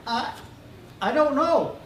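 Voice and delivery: lightly voiced timid little boy, high; small unsure kid's answer. A narrator's reading. Sick boy answering the doctor, lightly timid.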